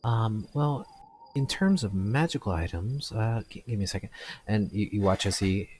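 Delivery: ordinary talk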